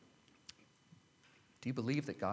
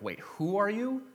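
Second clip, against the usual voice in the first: high, rising disbelief on YOU; incredulous intonation; 11 semitones higher than usual. Shown as a demonstration. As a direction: stop short, then ask "who are you" way up high. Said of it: "the intonation is more like".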